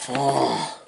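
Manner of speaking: plain reading voice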